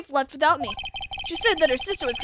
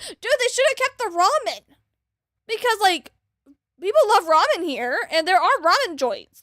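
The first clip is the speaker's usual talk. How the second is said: louder and higher, all fired up; worked-up ramen rant; excited rant; 6 semitones higher, 5 dB louder than usual. Real, not acted. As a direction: gush about ramen.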